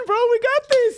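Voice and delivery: high squeaky falsetto. Acted.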